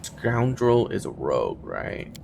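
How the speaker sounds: usual speaking voice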